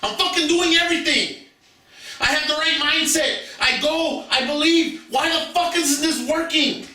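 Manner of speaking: high, strained and fed up, reliving the frustration; exasperated rant. Retold or imitated. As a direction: vent like you've tried everything and nothing works. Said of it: "And I would be like".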